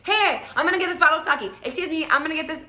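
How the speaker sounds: pushing people aside; aggressive. Retold or imitated. Date announcing to people walking by, loud. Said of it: "he was even aggressive towards".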